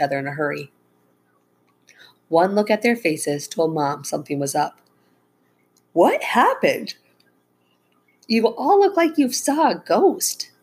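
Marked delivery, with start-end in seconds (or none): 5.9-10.5 high, swooping startled questioning; alarmed surprise. A narrator's reading. Mom seeing her family's faces, alarmed. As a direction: exclaim it with rising concern.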